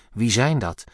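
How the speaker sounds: plain reading voice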